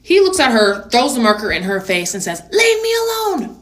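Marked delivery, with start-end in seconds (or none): 2.5-3.6 very high-pitched mock kid outburst; squealed tantrum in a put-on child voice; bratty child. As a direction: imitate a little boy snapping at his mom. Acts out kid yelling at mom.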